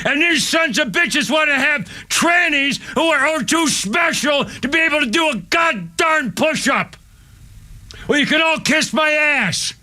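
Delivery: high, drawn-out, anguished shouting; furious outburst. Real, not acted. Ex-Marine raging that his man dropped dead in training, furious. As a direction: shout each phrase slowly with high, outraged force. Enraged tirade over dead comrade.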